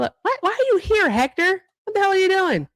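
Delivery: surprised teasing call to Hector. Real, not acted. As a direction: tease a friend who showed up early. Calling out to Hector arriving.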